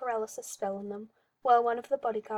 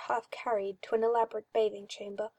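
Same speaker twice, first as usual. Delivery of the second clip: plain reading voice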